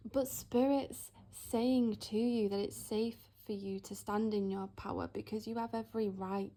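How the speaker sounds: ordinary talk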